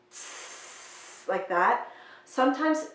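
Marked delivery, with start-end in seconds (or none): 0.1-1.3 a "t" with stretched air after; "t" released into a long hiss of air. Shown as a demonstration. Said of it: "stretch that air on the t".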